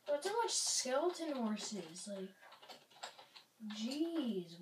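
ordinary talk

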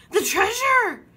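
high, loud alarmed boom; crew booming. A narrator's reading. Pirate crew fearing for treasure, booming cry. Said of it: "boomed the crew".

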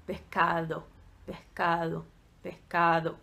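"mercado" and "Pecado" low, with a slack D; low, soft "Pecado" with a barely touched D; lazy D. Shown as a demonstration. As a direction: soften the D in "mercado" as if too lazy. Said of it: "com preguiça de falar o D".

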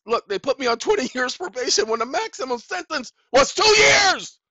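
worked-up rant building to a shout; real indignation, high and loud, ending in a yell; emotional outburst. Real, not acted. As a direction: let outrage erupt on "two years". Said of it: "I'm emotional right now".

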